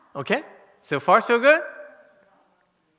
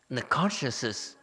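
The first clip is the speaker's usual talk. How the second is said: usual speaking voice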